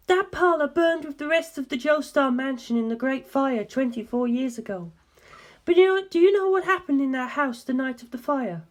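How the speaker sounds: a hint of a grave woman asking; earnest, probing question, lightly voiced; a faint woman's voice, asking seriously; lightly voiced Darlington. A narrator's reading.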